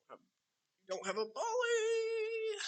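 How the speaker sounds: high, drawn-out gleeful cry; playful crowing. Pretend. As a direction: stretch it into a soaring, teasing wail. Crowing over opponent lacking a Poly.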